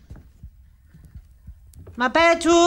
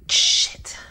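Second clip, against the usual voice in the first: whisper. Real, not acted. Alone in the dark.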